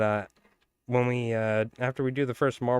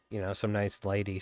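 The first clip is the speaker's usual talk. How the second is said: ordinary delivery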